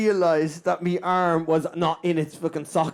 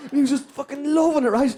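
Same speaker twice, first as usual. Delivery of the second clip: ordinary talk, nothing marked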